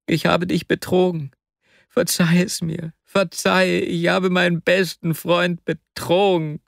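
high, anguished begging for forgiveness; distraught friend confessing. Acted. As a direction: plead desperately, overcome with guilt. Vasja begs forgiveness in tears.

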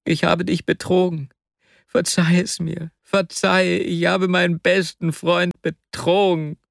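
high, strained-sounding begging for forgiveness; anguished plea. A narrator's reading. Vasja confessing to his best friend, anguished plea.

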